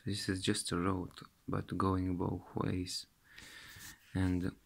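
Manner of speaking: ordinary talk, nothing marked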